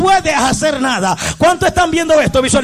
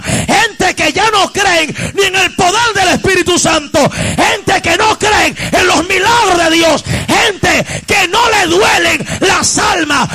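full-force preaching; 3 semitones higher than usual. Real, not acted. Preacher rebuking a worldly church, full-force preaching. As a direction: cry it out phrase by phrase.

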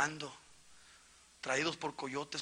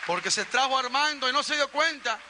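loud, high-pitched preacher building to a shout; impassioned preaching; 11 semitones higher, 6 dB louder than usual. Real, not acted. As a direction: preach it at full force, climbing to a long, shouted final cry. Preaching at full force. (prédica exaltada que sube hasta el grito)

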